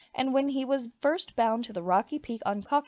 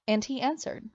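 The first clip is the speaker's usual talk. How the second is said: plain reading voice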